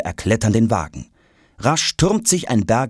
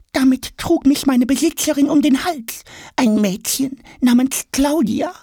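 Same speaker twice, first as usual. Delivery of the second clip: very high squeaky small-creature voice; little figure explaining. A narrator's reading. Little brace box explains. (piepsige Figurenstimme)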